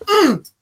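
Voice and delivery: squeaky strained hum; a tight, high mock-mad grunt; high angry grunt. Imitating Gobert's feeble angry punch with a high hum. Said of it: "He was like".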